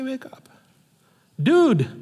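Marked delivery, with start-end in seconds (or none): 0.0-0.5 "Wake up" said very softly, almost breathed; hushed wake up. Shown as a demonstration. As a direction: drop "Wake up" to a near hush. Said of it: "Why would you say".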